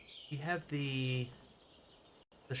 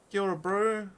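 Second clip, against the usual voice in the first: higher, louder hammed-up New Zealand accent; mock Kiwi greeting; 7 semitones higher, 5 dB louder than usual. Shown as a demonstration. Greeting the Kiwi viewer in a jokey New Zealand accent. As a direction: greet him like a cheeky Kiwi bloke. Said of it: "My authentic New Zealand accent".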